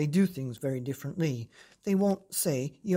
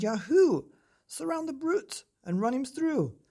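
screaming mob; 10 semitones higher than usual. A narrator's reading. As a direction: whoop it like a charging mob. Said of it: "They screamed".

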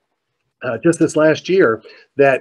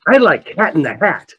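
put-on higher-pitched little girl's voice; 4 semitones higher than usual. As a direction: imitate an eager little girl speaking up. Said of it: "One little girl off to the side".

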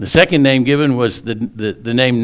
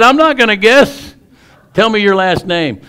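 much higher, louder exasperated yell; yelling teacher; 11 semitones higher, 6 dB louder than usual. Retold or imitated. Teacher yelling at the stubborn boy, high furious shout. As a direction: lose patience and yell the demand. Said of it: "she's yelling at him".

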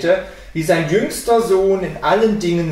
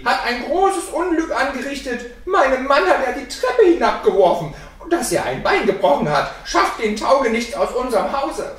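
high, agitated accusation; loud upset complaint, pitched up; clamouring woman; 9 semitones higher, 4 dB louder than usual. A narrator's reading. Wife accuses the boy to his father, clamouring high. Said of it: "mit lautem Geschrei zum Vater".

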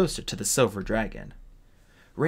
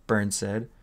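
usual speaking voice

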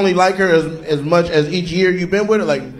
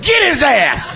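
mock shout; 7 semitones higher, much slower than usual. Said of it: "And she like".

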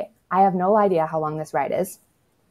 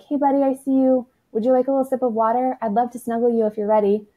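put-on higher, gently reassuring voice for a toddler; soothing parent checking in, pitched up; clear calm voice; 6 semitones higher, 3 dB louder than usual. Shown as a demonstration. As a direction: call out kindly to a tantruming child, a bit louder. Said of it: "really clear, calm voice".

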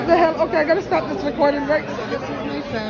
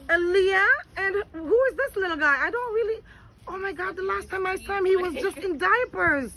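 normal talking voice

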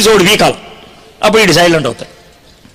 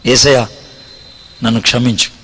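tearful prayer; 8 semitones lower than usual. Retold or imitated.

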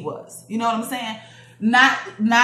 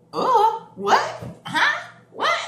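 very high-pitched baffled squeaks; short, high questioning bursts of imitated confusion; mock bewilderment; 13 semitones higher, 3 dB louder, much slower than usual. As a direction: react as a clueless kid, hammed up. Bewildered child reacting to question.

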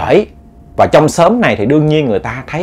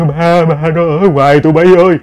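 a hint of a creepy frightened old woman; eerie old voice; 3 semitones higher than usual. Grandmother whispering of the ghost to the girls, lightly voiced eerie dread. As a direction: chill the listeners with a lightly voiced old granny. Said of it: "Cái âm thanh đó nó ghê lắm".

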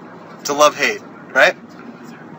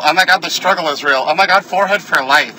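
lightly high, louder gushing fan voice; mock fangirl; 5 semitones higher, 5 dB louder than usual. Mocking fans about his forehead, a gushing fangirl voice. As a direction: play a swooning fan.

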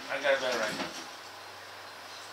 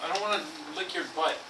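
normal talking voice

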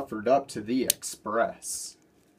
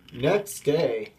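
plain reading voice

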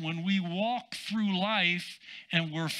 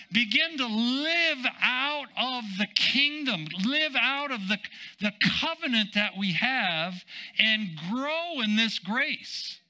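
raised, stretched-out exhortation; emphatic preaching; 5 semitones higher than usual. Real, not acted. Preacher urging believers to live by the covenant, emphatic preaching. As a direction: urge the congregation on, leaning long on key words.

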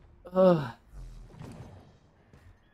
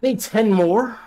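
loud, higher, disbelieving; can't believe it's ten more; indignant disbelief; 5 semitones higher, 7 dB louder than usual. Real, not acted. Gamer realizing they still need ten more, loud disbelief. Frustrated gamer, short on items.

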